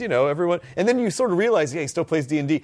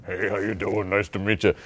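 Vin Diesel's expected voice; 7 semitones lower than usual. Acted. Expecting an action star's greeting, low put-on voice. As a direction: imitate a deep-voiced action star saying hello. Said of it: "it just came out more like this".

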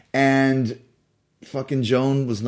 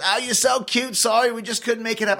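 lightly voiced sweet older lady, pitched higher; sugary Joan Rivers; 9 semitones higher than usual. Joan brushing off the young reporter, sugary high mock sweetness. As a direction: coo it up high like a breezy star brushing him off. Joan apologizes to the snubbed guest.

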